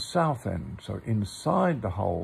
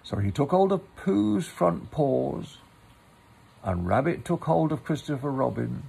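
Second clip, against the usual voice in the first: story narration; 5 semitones higher, 4 dB louder than usual. A narrator's reading. Reads a Pooh story aloud.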